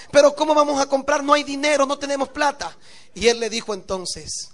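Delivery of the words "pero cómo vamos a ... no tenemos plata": worried protest. Acted. Worried wife protests spending.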